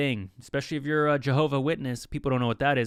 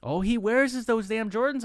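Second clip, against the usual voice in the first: higher-pitched grumbling auntie; talking crap; 8 semitones higher than usual. Acted. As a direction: run him down in a raised-pitch mock auntie voice. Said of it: "they start talking crap about the uncle".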